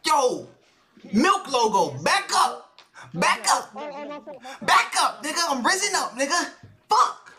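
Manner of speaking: high, agitated warning to someone intruding; worked-up yelling at an intruder; agitated calling out. Real, not acted. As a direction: call them off, pitch jumping up.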